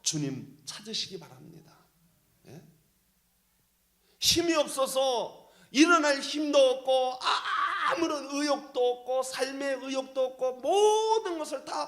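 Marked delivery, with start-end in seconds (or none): 4.2-11.9 louder and higher, crying out; crying shout. Real, not acted. 힘든 성도들의 절망을 외치며, crying shout. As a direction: cry it out with breaking force. Impassioned preaching on despair.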